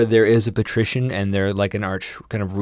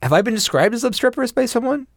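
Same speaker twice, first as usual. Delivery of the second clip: high indignant; 14 semitones higher than usual. Real, not acted. Mock offended high pitch.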